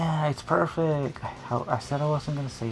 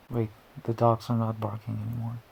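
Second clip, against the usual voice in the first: normal talking voice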